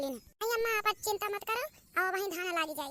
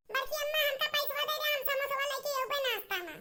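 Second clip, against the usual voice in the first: put-on high, raised voice of a child calling out; child calling mother; 5 semitones higher than usual. Child rousing mother to plant paddy, calling high. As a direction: call her up from bed, high and eager. Child asking aunt for money.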